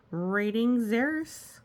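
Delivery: plain reading voice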